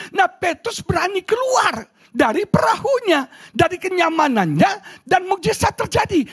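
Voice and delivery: high, insistent sermon delivery; rousing preaching. Acted. Preacher rallies congregation with Peter leaving the boat, rousing high preaching.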